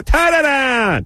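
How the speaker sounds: very high, drawn-out mock yell; yelling Jamaican woman. Jamaican searcher to clueless traveler, imitated yelling. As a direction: imitate an exasperated Jamaican guard's garbled shout. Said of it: "yelling now".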